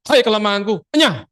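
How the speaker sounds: preacher showing a warfare prayer, commanding; battle rebuke. Shown as a demonstration. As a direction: shout down the weakness. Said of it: "Perang konsekrasinya".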